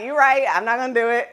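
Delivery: laughing. Retold or imitated. Laughing while quoting herself.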